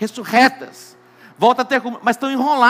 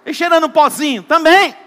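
higher, louder jokey answer; playful exclaim; 8 semitones higher, 8 dB louder than usual. Real, not acted. Preacher joking with the crowd, loud and playful. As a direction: toss it out for a laugh. Preacher jokes about baptism.

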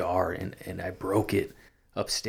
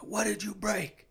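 mom's voice, much higher, snapping the question; angry mother; 13 semitones higher, slower than usual. Retold or imitated. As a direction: imitate mom demanding angrily. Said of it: "like, angry".

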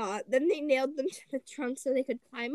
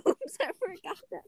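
high-pitched storybook "oops" moment; high voice for a frog's slip-up; crying out; 7 semitones higher than usual. A narrator's reading. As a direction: lift it high, sheepish little cry. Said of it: "cried Froggy".

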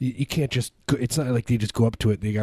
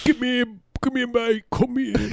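very high-pitched British caricature; mock British bishop. British bishop calling a mate over, exaggerated British accent. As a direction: call out in a silly, squeaky British accent. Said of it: "make fun of British people".

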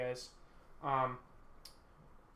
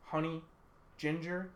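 usual speaking voice

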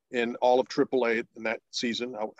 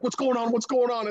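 higher, curious repeated question; eager wife; 10 semitones higher than usual. Retold or imitated. Wife at dinner asking her husband, higher, eager. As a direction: press to know the news, pitch raised. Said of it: "she's like".

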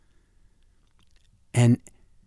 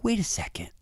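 shocked whisper; 9 semitones higher, 5 dB quieter than usual. Retold or imitated. Shocked narrator hears the abuse.

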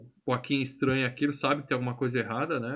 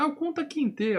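mock high Kunta Kinte. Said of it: "Ele fala".

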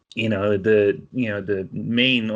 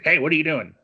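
mock guard calling out, higher pitched; lightly acted suspicious challenge; challenging guard; 8 semitones higher, 4 dB louder, faster than usual. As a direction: call it out like a guard confronting someone.

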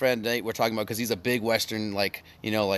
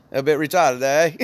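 playful Australian accent, raised a little louder; 3 semitones higher, 6 dB louder, slower than usual. Shown as a demonstration. Showing co-hosts how it sounds, Australian accent. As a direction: put on an Australian twang. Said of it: "it's like an Australian".

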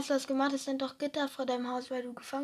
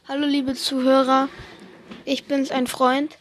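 podcast host greeting; 10 dB louder than usual. Pretend. Friend asked to talk, loud mock host greeting. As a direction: greet the listeners like a show host. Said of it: "Einfach über dein Live".

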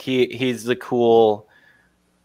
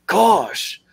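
higher, exasperated mock groan; sulky teen sigh of a word; Napoleon Dynamite voice; 6 semitones higher than usual. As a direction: Napoleon Dynamite's annoyed gosh. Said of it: "And he's like".

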